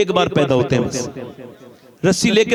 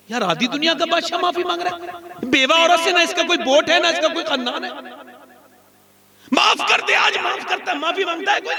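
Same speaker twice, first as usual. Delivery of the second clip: much higher, rising fervent appeal; impassioned preaching; 8 semitones higher than usual. Acted. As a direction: marvel aloud at the emperor's humility. Impassioned preaching about humility.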